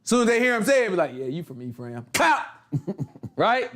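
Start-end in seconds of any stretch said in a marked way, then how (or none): 1.1-2.1 quiet acted verdict before striking; knowing aside. Acted gatekeeper catching an Ephraimite. 2.1-2.4 sudden punchy "pow" sound effect; abrupt mimed strike; imitated blow. Imitates the blow after the verdict.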